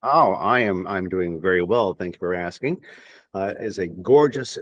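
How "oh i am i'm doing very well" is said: normal talking voice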